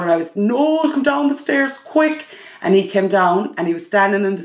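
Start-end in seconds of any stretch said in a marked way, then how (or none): 0.3-2.3 calling up the stairs, pitched higher in alarm; urgent call to the husband upstairs, mildly shouted; alarmed call, higher, not truly yelled; retold roar. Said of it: "I just left a roar out".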